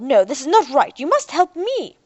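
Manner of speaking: Doctor Avery demands help in a loud rage; angry demand. A narrator's reading. Avery continues roaring demand.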